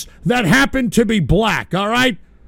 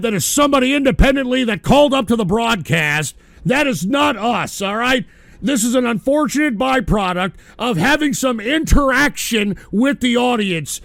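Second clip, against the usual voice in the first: ordinary talk, nothing marked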